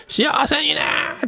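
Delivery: very high, slow drawn-out shout; long, soaring farewell call; shouted send-off. Retold or imitated. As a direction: yell it out of a taxi window to friends. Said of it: "ってでかい声で".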